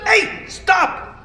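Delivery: high indignant hey stop; upset protest. Acted. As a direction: tell the laughing crowd to quit. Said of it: "Amik was really upset".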